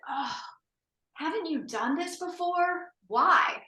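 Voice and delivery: mock exasperated doctor, high and slow. Male doctor dismissing patient's birth plan, mock exasperated drawl. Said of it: "he sighed, this big exasperated sigh".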